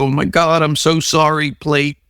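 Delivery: high, gushing fake sorry; mock apology. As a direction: apologize theatrically to a broken plate. Mock apology to broken plate.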